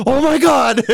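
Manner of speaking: high, loud, laughing gasp of shock; kids shocked. Retold or imitated. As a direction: squeal it like a spooked kid at a sleepover. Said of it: "they're like".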